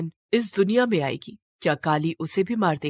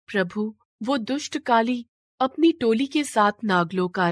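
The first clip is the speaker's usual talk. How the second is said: pleading queen; 3 semitones higher than usual. Acted. Shivalika begs Lord Shiva to save Naglok, earnest and pleading. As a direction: beg the god humbly for her people.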